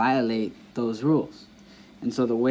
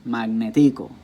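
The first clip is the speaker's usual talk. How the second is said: Magnetico with "ti" stressed and louder, like "mag-ne-TEE-co"; deliberately misplaced stress; 5 dB louder than usual. Shown as a demonstration. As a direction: wrongly punch the "ti" in Magnetico. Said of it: "try to pronounce the words incorrectly".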